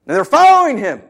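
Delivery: ordinary delivery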